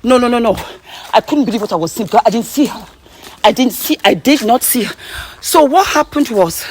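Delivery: panting, breathless telling; out of breath. Real, not acted. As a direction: talk while catching your breath. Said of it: "jeez, we're out of breath".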